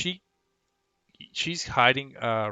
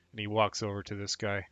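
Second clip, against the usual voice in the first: usual speaking voice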